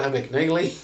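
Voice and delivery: "Abagnale" as "Abag-NAY-lee", incredulous; mocked mispronunciation. Real, not acted. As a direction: scoff at "Abagnale" ending in "-naylee". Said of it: "who the fuck thought".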